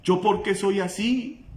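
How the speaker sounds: high pleading cry for help; retold shout. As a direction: cry out desperately, then ask. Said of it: "Le gritaba".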